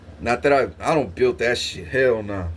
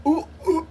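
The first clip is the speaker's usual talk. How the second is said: much higher strained gasps; mock choking gasps; 14 semitones higher than usual. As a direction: gasp like food is stuck in your throat. Choking on sponge burger.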